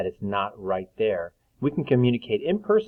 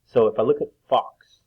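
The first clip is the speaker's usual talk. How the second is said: usual speaking voice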